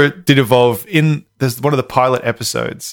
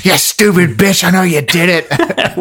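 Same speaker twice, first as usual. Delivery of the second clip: higher, mock angry yell; imitating a yell; 5 semitones higher, 3 dB louder, slower than usual. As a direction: play the detective bullying a suspect. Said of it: "He's fucking yelling at her".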